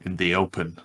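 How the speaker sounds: usual speaking voice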